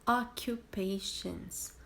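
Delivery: "occupations" said slowly as o-ku-PAY-shons; slow model word. Shown as a demonstration. Teacher introducing the vocabulary word slowly. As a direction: draw out "occupations" clearly. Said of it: "Depois nós falamos de".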